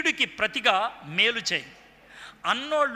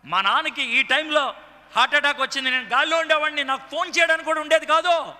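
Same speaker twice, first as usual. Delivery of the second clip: building to a high, loud outpouring; retold agitated realization; 5 semitones higher, 4 dB louder than usual. A friend on the phone realizing he'd have missed his father's death, building agitation. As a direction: pour it out with mounting intensity. Retelling a friend's near-miss with rising emotion.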